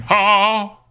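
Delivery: drawn-out cry attempted in a Texan accent. Shown as a demonstration. Streamer tests whether the cry works, Texan accent. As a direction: try the whoop with a Texan twang. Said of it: "I can't do that in action".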